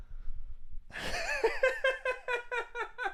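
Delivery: bursts into high-pitched laughter; laughs at himself losing it. Real, not acted. Ranting about the store clerk, breaks into laughter.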